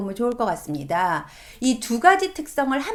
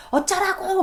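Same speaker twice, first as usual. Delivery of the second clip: mock yell; 9 semitones higher than usual. Teen son yelling back at his mother, mock yell. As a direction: toss it back up high like a defiant teen. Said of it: "소리를 지르는 거".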